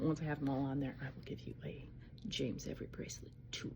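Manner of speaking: a soft conspiratorial whisper. Real, not acted. Promising viewers a bracelet tour, in a whisper.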